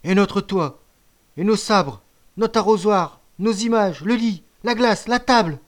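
high, loud list of losses; indignant lament. A narrator's reading. Boys listing what was stolen from the hut, indignant lament. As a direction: cry out each lost thing in short bursts.

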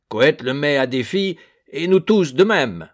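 heated backing. A narrator's reading. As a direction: back him up loudly. Perchet soutient Doublenerre avec force. (Perchet appuie Doublenerre, fort et échauffé)

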